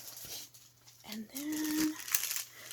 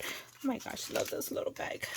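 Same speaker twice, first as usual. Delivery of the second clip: hushed aside; 2 semitones higher, 7 dB quieter than usual. Real, not acted. Sudden hushed aside over gift.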